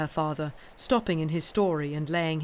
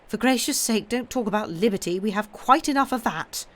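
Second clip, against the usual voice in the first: louder, higher exasperated nurse; exasperated Miss Pross; 4 semitones higher, 5 dB louder than usual. Acted. Miss Pross snapping at the doctor about liberty, louder. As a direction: bristle at the word liberty.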